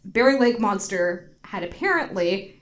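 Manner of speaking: ordinary talk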